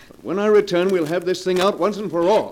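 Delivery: high, loud ultimatum; stern threat. Acted. Husband warning wife before leaving, loud stern ultimatum. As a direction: promise a reckoning.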